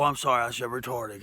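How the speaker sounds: ordinary talk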